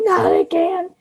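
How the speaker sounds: high, teary plea; distraught pleading. Acted. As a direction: plead in a breaking voice. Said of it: "Bill is saying".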